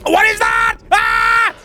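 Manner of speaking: high frightened shout; startled scream at a shock; scared horror game streamer. Real, not acted. Sudden scare.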